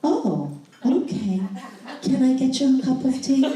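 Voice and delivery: husband obediently offering his wife tea, mildly sheepish; husband's meek reply. Retold or imitated. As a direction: repeat it dutifully like a man who got the message. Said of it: "And he just went".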